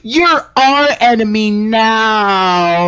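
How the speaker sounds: voicing the friends. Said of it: "so they said".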